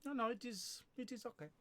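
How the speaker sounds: high, modest French-accented shrug. Shown as a demonstration. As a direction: put on a hesitant stage-French accent. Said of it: "French is an accent I can do".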